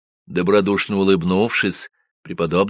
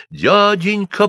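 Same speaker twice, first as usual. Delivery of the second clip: высокий детский лепет; робко пролепетать, как маленькая девочка; 13 semitones higher, 5 dB louder, much slower than usual. Acted. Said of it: "пролепетала я". (лепечет девочка)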